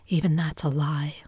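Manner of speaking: ordinary delivery, nothing marked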